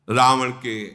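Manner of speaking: ordinary talk, nothing marked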